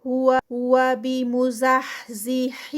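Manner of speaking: slow, drawn-out tajweed recitation; Quranic recitation. Shown as a demonstration. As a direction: chant it slowly, stretching each sound. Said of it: "ہا کی sound". (آہستہ کھینچ کر تلاوت)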